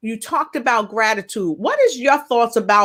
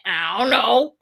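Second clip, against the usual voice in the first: fake sick voice; 2 semitones lower than usual. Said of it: "I'm sick" voice".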